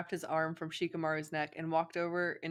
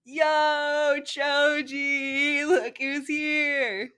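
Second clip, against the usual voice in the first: put-on high loud slow drunk call; loud slurred shout to a friend; drunken yell; 9 semitones higher, 9 dB louder, much slower than usual. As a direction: holler across the party, swaying. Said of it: "Naruto yelled a little as he swayed".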